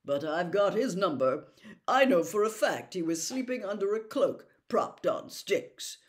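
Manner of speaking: loud, self-important official; pompous Percy. A narrator's reading. Percy boasting to the family about his busy week, pompous. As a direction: boast of your hard work, puffed up.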